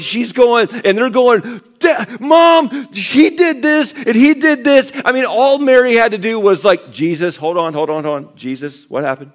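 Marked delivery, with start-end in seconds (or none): 1.8-4.9 put-on much higher, loud childish shout; whiny tattling kid. James tattling to Mary about Jesus, high whiny shout. 6.9-9.3 mild calm mother. Retold or imitated. Mary calmly siding with Jesus.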